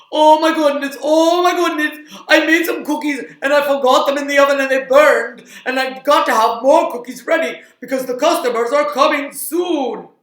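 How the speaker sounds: high, loud, frantic puppet voice; panicked puppet chef. Acted. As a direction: cry out in cartoon panic over burnt cookies. Puppet chef panicking over burnt cookies.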